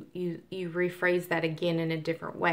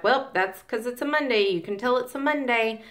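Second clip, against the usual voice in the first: higher, louder whiny coworker voice; mock coworker excuse; 6 semitones higher, 4 dB louder than usual. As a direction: play the coworker blaming Monday, pitched up. Coworkers excusing the busy Monday.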